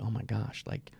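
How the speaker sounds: whispered guilty pleasure; secret whisper. Retold or imitated. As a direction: confide it under your breath. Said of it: "I would not say that out loud".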